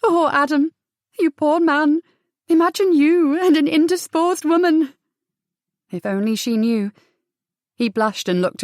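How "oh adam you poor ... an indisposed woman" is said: higher, louder sister poking fun; amused teasing. A narrator's reading. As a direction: tease your poor brother with mirth. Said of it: "clapped a hand over her mouth and laughed".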